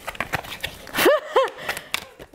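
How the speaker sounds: high breathless giggle; giggling helplessly. Real, not acted. Drawing tarot cards, giggling.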